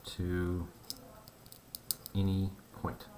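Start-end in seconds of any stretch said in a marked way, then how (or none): 2.1-3.0 "any" set apart and leaned on, then "point"; stress on ANY. Real, not acted. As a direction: lean on "any", leave a small gap before "point". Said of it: "I do have to stress that word".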